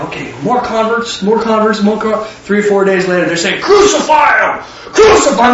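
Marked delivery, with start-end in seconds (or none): 3.6-5.5 bay it like a hostile mob; mob shout. Acted. Crowd turns on Yehoshua.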